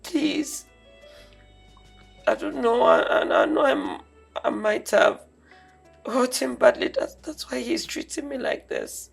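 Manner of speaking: soft tearful pleading. Acted. Daughter begs her father to forgive them. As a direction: beg them for mercy.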